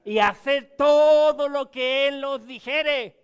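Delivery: loud, slow preaching of the verse; forceful scripture reading. Acted. Preacher reads the verse to his flock, loud and slow. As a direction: proclaim it to the congregation, slowly.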